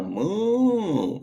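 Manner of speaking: cow moo. Retold or imitated. Imitating the cow calling.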